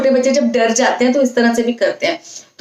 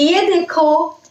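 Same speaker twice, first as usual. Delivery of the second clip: put-on tender mother showing a toddler; lovingly; 6 semitones higher, much slower than usual. Shown as a demonstration. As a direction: gently show a baby something. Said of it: "अगर प्यार से उसको कुछ दिखाना है".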